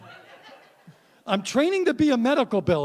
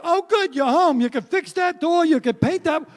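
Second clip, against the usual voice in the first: high, louder, bossy wife voice; nagging wife; 7 semitones higher, 5 dB louder than usual. Retold or imitated. Husband mimics wife listing chores in high nag. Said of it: "She's like".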